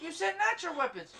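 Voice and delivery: high, indignant accusation; mock outrage. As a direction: call out the cheat up high.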